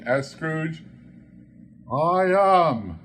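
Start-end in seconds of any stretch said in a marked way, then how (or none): 1.9-3.0 soft ghost voice. Acted. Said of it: "The voice was soft and gentle, singularly low".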